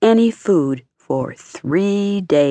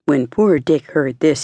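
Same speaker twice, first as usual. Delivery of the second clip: ordinary delivery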